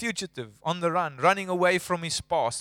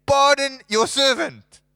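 higher, louder, slow drawn-out plea; pleading Moses; 7 semitones higher, 6 dB louder, slower than usual. Retold or imitated. Moses at the end pleading with God, higher and louder. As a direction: stretch out the pardon like begging. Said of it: "he's saying".

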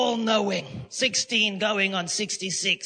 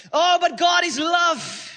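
high, loud, slow mock protest; 6 semitones higher, 5 dB louder, slower than usual. Sinner argues away God's judgment, loud high protest. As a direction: cry out the excuse, stretched out. Acted excuse, louder repeat.

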